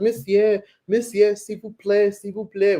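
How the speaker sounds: high insistent plea in French, imitated; begging kid. Six-year-old blocking a tourist, begging in high French. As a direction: whine it like a small boy selling tissues. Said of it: "he's like".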